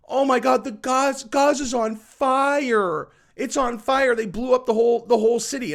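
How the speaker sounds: high, loud, hammed-up wailing alarm; mock panic. Mimicking the world's reaction to Gaza, in high mock panic. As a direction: parody a hysterical crowd crying that the city burns.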